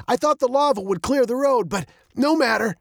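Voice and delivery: high, animated dad voice; undaunted dad. A narrator's reading. Dad sees the plan fail, high and undaunted.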